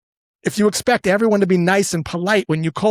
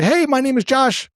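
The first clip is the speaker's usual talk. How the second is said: salesperson greeting; 8 semitones higher than usual. Retold or imitated. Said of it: "salesperson typically always will say".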